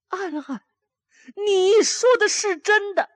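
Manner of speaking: can't believe the news; astonished. A narrator's reading.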